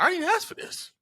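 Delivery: put-on high-pitched kid objection; acted-out child's disbelief; indignant protest.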